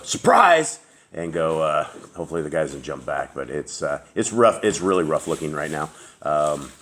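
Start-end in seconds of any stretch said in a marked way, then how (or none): 0.0-0.8 high, loud mock surprise; sudden high-pitched exclamation, hammed up; acted surprise shout. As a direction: leap out at someone with a playful shout. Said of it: "open the door and go".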